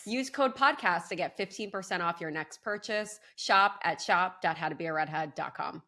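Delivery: scripted promo code read. Acted. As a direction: read the code and web address clearly. Host gives promo code.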